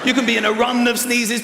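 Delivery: ordinary talk, nothing marked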